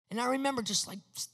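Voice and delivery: ordinary talk, nothing marked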